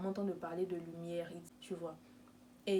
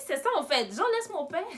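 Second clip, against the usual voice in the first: loud and higher, fed up; retold shout; 8 semitones higher, 7 dB louder than usual. Telling the harassing caller loudly to leave her alone. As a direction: snap it at him to be left alone. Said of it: "tu me fais crier".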